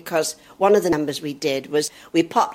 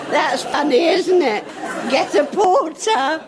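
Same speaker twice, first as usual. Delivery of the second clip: high, laughing, slowing on get a porter; amused disbelief; 9 semitones higher, slower than usual. Retold or imitated. Wife mocking husband's advice to get a porter. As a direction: savour the absurdity of a porter. Her own thought mimicked.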